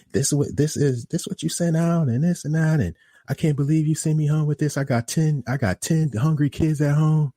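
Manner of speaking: high-pitched mock tirade; imitating customer going off. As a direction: act out an irate customer's high-pitched complaint. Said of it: "going off".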